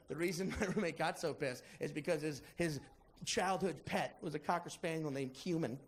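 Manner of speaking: ordinary talk, nothing marked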